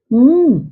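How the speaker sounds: उम as a closed-lip hum 'mm'; letter sound. Shown as a demonstration. Teacher gives pupils the sound of M, hummed उम. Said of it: "इसका उच्चारण होगा".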